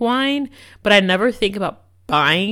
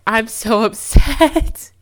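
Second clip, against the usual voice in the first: slow, laughing through the words; a real laugh breaks up the complaint; laughing while upset; 4 semitones higher, 6 dB louder, much slower than usual. Real, not acted. Telling listeners about her morning, upset and laughing.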